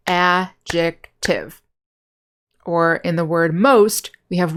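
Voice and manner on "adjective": slow, deliberate adjective, each syllable said clearly; syllables spelled out slowly. Shown as a demonstration. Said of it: "we have three syllables".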